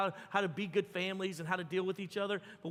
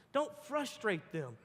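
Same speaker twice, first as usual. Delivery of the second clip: ordinary delivery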